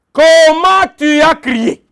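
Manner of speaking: slow, weighty question, Comment leaned on; each word laid down slowly; emphatic question. Acted. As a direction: drive the question home to the congregation. Preacher hammers key question.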